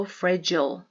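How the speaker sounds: "fragile" with a long "eye" in the second syllable; "fragile" ending like "aisle"; alternative pronunciation. Shown as a demonstration. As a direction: say "fragile" as FRA-jile. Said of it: "또는 fragile로 발음하셔도".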